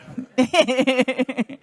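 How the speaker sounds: real giggle after a stumble; self-conscious laugh. Real, not acted. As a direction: laugh off your own tongue-twist. Laughs at her own stumble.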